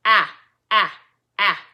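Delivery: short A sound "Ah", loud and punchy; letter-sound drill. Shown as a demonstration. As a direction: chant "Ah" as three separate, loud short vowels. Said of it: "Allie Alligator says".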